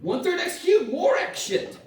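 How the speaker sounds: loud, high-pitched exclamation; comic exasperated yell; exasperated outburst. Real, not acted. Exasperated at the worsening integral.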